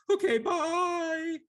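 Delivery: very high, slow, tearful little sludge voice; timid Lemure. Acted. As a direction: squeak it out like a weepy blob creature. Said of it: "and he goes".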